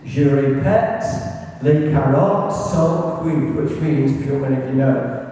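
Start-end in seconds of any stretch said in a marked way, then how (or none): 0.1-1.0 put-on English-accented French, a touch louder; terrible French accent. History talk, radio operator repeating the coded message, English-accented French. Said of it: "despite my terrible French accent". 1.6-3.6 put-on repeated in English-accented French, a little quicker; terrible French accent. History talk, repeating the coded message to the Resistance, bad French accent. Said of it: "despite my terrible French accent".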